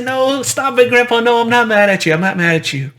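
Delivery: put-on protesting teen. Teen grandchild protesting grandpa's poking in a high whine. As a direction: squirm away from a tickle and whine.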